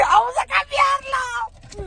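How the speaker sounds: high, slow thrilled yell; excited shout. Real, not acted. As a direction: cheer it out. Going to cash the win.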